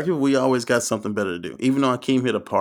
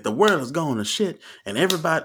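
higher-pitched, sing-song mock preacher; 7 semitones higher than usual. Preacher telling church the world's going bad, mock preaching. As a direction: imitate a preacher working up the pulpit. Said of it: "first time I ever heard a pastor ever cuss".

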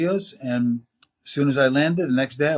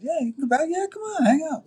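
much higher, imitated friendly invitation; eager welcome; faster than usual. Studio musicians invite the teenager back, imitated eager welcome. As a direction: act out an enthusiastic come-along in a high voice.

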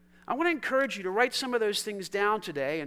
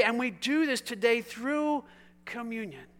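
ordinary delivery

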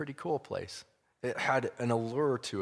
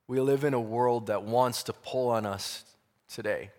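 building preacher's emphasis; preaching; 4 dB louder than usual. Acted. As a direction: preach it with rising force. Sermon-like commentary building intensity.

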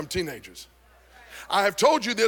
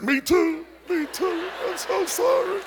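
put-on higher, whiny "me too, me too" of the kids; mock family chorus; 10 semitones higher, slower than usual. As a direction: play the whole family piling on sorry. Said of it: "everybody's like".

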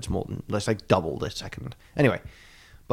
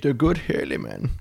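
put-on higher silly Dutch accent; comic Dutch rendering, pitched up; mock Dutch; 6 semitones higher than usual. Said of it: "say it in a silly accent".

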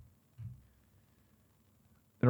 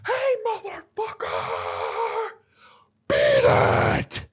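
strained, drawn-out holler held back in volume; mock yard-owner yell; 7 semitones lower, much slower than usual. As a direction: holler at kids cutting through the yard. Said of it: "if I'm forty-eight and they're twelve".

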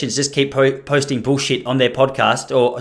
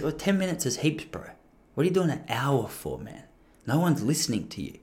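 quiet, confidential gangster impression; 12 dB quieter than usual. Comedian pulls a mate aside to advise him, hushed gangster voice. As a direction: imitate a gangster giving advice under his breath. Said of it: "a little more gangster than he is".

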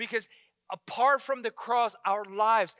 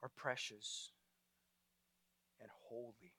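hushed prayer; 8 semitones lower, 12 dB quieter than usual. Real, not acted. Preacher leads the congregation in prayer, soft and reverent. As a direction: pray gently before God.

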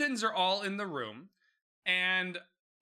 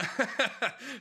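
short burst of real laughter; amused laugh; 5 semitones higher than usual. Real, not acted. Podcaster amused by the scene she recounts, bursting into laughter. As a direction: break into a laugh mid-sentence.

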